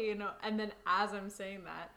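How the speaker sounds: ordinary delivery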